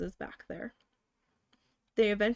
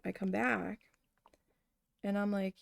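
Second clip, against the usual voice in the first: normal talking voice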